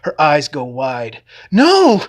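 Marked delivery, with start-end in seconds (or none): 1.5-2.0 very high, loud shriek; scream. A narrator's reading. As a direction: scream as the bird falls. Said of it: "she screams".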